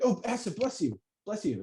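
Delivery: high, soft churchy blessing; mock pious voice. A host mocks people who bless sneezes, high churchy gush. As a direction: gush like an overly pious believer. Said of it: "they're like".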